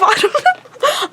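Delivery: high, slow laugh through the words. Real, not acted. Vlogger laughing at her own outburst, high and slow. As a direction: laugh it off after swearing.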